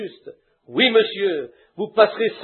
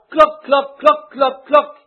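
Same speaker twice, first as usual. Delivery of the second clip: hen's clucking cry; 5 semitones higher, slower than usual. Retold or imitated. Preacher voicing the old hen calling her chicks, high mock clucking. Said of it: "La vieille poule cria".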